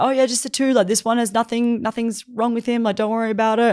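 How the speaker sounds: high-pitched, mock-casual fibbing; covering up a lie. Officials lying to Rose about the heroes, covering up. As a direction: imitate someone trying too hard to brush it off. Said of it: "They're trying to cover up".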